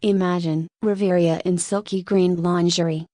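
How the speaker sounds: ordinary delivery, nothing marked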